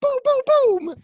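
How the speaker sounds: a little boy shooting pretend aliens; kid playing. Kid shooting at the spaceship, high playful booms. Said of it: "He's like".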